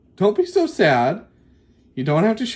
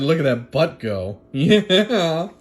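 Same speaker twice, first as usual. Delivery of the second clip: ordinary talk, nothing marked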